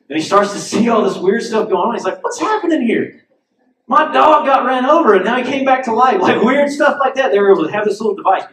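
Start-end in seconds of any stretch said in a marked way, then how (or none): 2.2-6.2 bewildered. Retold or imitated. Bewildered stranger voiced in story.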